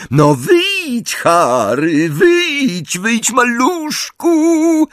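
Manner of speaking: high, drawn-out singsong taunt; slow, high-pitched babyish jeering; mocking baby voice. A narrator's reading. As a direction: coax tauntingly in a child's voice. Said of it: "naśladując kpiąco głos dziecka".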